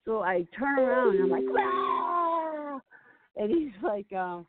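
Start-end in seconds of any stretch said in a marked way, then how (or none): 1.6-2.8 long, high mock scream; high drawn-out imitated yell; retold scream. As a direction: scream as if charging at someone, in retelling.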